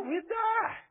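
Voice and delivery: high-pitched, loud mock outcry; mock panic. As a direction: exaggerate the horror in a high wail. Mock protest at missing a meal.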